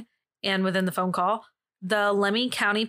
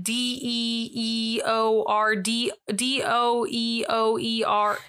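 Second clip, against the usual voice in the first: slow spelling back; much slower than usual. Retold or imitated. Operator slowly spelling back.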